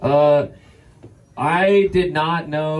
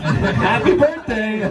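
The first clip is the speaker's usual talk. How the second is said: mock cheerful gift; 8 semitones higher, much slower than usual. Mock presenting clipped flowers.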